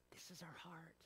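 hushed whisper. Real, not acted. Preacher whispering to the church. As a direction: drop to a whisper to make it intimate.

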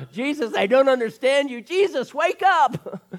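high, loud cry to wake someone; hammed-up frantic disciple yelling; mock panicked shout. Disciples waking Jesus on boat.

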